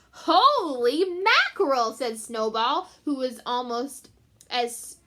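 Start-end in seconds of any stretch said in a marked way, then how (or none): 0.1-1.9 surprised cat. Acted. Cat exclaims loudly, slow.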